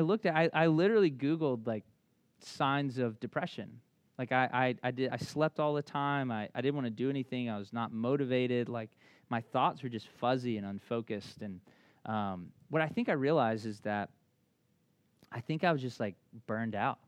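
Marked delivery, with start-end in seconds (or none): none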